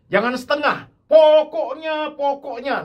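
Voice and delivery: high, loud, slowly stretched pokoknya; fervent insistence. Real, not acted. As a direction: urge the church not to do things by halves. Preacher insists, high loud slow. (desakan penuh semangat, tinggi dan lambat)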